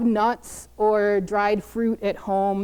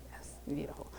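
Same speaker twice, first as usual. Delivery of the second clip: whisper; 7 semitones lower, 19 dB quieter than usual. Real, not acted. Whispered aside.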